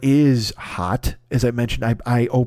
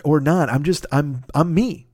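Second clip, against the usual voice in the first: ordinary talk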